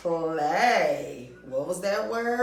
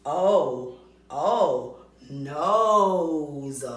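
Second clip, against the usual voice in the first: O stretched and pressed before nose; O said firmly twice, then nose; stressing the O; 2 semitones lower, 4 dB louder, much slower than usual. Shown as a demonstration. Teacher pointing out the long O in nose. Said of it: "we hear the".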